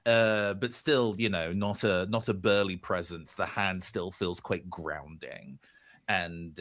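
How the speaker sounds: ordinary delivery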